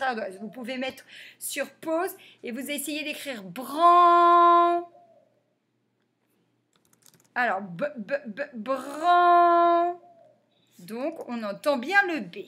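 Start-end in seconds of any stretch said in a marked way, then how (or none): 3.4-4.9 "bran" with a long held vowel, louder; sounded out. Shown as a demonstration. Teacher dictating to pupils, "bran" drawn out. 8.0-10.0 "b, b, bran" with a long ringing vowel; sounded out. Shown as a demonstration. Teacher helping pupils hear the letters, "b, b, bran" sounded out.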